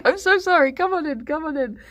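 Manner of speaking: high, hurried-to-please apology; flustered mock apology. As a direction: act out apologizing and ushering someone in. Flustered apology after slamming door.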